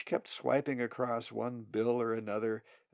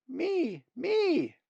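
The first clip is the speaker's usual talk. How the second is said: high squeaky chick voice, louder; begging nestling; 7 dB louder than usual. Acted. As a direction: peep it out, desperate to be fed first.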